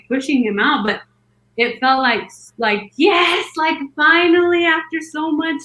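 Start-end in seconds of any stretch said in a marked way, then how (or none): 3.0-5.6 high, elated cry of release; raised-pitch celebration; triumphant relief. Retold or imitated. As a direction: relive the moment of finally succeeding. Relief as the head comes out.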